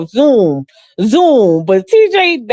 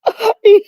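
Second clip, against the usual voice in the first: real high laugh; big laugh; 12 semitones higher than usual. Real, not acted. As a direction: crack up at the memory. Laugh after story.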